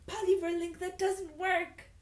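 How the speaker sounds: loud mock announcement. Real, not acted. Reader hitting a second broken dress link, high mock announcement. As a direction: belt out the dead link in a high voice.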